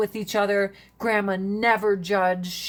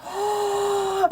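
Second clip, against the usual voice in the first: sudden realization; 12 semitones higher than usual. Retold or imitated. Gasp of realization.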